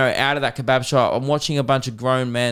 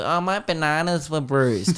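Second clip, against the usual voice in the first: whiny customer; 3 semitones higher than usual. Retold or imitated.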